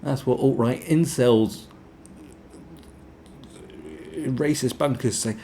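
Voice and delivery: mocking high sneer of a critic. Mocking a smug critic who dismisses him. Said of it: "You can go".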